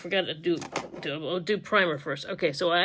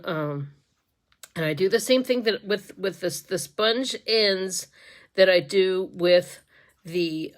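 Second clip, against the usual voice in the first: normal talking voice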